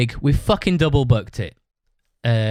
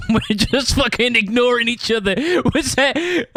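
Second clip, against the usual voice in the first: laughing through the words, pitched up; 11 semitones higher than usual. Real, not acted. Telling a friend about the awkward practice, laughing. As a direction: giggle while telling how awkward it was.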